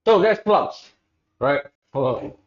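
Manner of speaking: talking through a mouthful of food; words muffled by food in mouth; mouth full. Real, not acted. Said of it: "My mouth is full".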